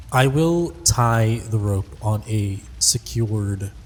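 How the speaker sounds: ordinary delivery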